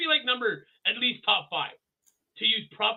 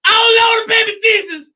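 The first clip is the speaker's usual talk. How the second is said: very high, loud wailing cry; mock shriek; 13 dB louder than usual. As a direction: shriek it like a hysterical woman. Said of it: "she goes".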